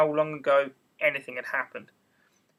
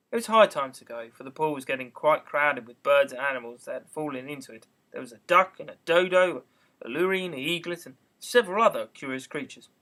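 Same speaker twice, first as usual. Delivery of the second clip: usual speaking voice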